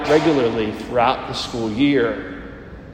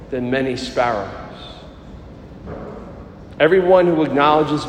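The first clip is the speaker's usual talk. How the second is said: solemn liturgical proclamation; Gospel reading. Acted. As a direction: proclaim it like scripture at Mass.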